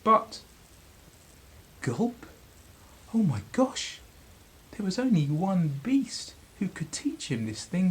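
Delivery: usual speaking voice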